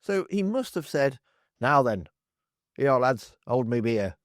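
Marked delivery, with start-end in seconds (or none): 1.6-4.1 hammed-up East Midlands accent, lightly done; Lee Anderson accent. Mocking the MP, in a bad East Midlands accent. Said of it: "that's really bad accent".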